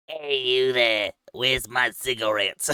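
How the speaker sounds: put-on old lady voice. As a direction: play a cranky old woman. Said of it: "You don't have to do old lady voice".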